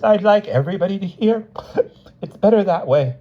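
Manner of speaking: high, loud tearful insistence. A narrator's reading. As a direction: insist through tears, pitched high. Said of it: "face was streaming with tears".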